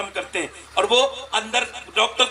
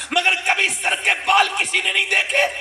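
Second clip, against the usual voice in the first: high fervent preaching; 10 semitones higher than usual. Acted. Preacher recounting Fatima's last wish, wailing. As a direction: wail it out to the congregation. Emotional retelling of Fatima's death.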